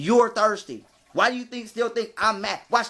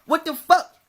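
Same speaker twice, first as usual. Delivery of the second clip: sudden high loud outburst, real shock; outraged disbelief; 9 semitones higher, 6 dB louder than usual. Real, not acted. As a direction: blurt it out, higher and louder.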